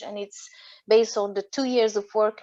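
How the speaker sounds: ordinary talk, nothing marked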